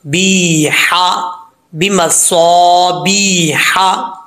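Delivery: बिमस्वाबिहा with a light, thin ha, slow. Shown as a demonstration. Teacher demonstrating the light ha to students, slowly. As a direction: recite slowly, keep the ha light. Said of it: "तो इसको बारीक पढ़ेंगे".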